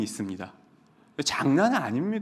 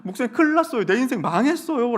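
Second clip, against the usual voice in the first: higher, louder, near-tearful plea; tearful wail; 7 semitones higher, 5 dB louder than usual. Retold or imitated. Student rushing in after the exam, near tears and louder. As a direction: wail about failing the exam. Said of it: "울먹울먹이면서요".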